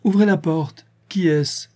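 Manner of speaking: father ordering the door opened; commanding shout. A narrator's reading.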